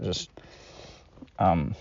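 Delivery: ordinary talk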